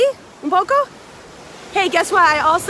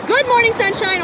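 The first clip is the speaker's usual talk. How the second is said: cheery greeting; 7 semitones higher than usual. Shown as a demonstration. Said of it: "I want you to say".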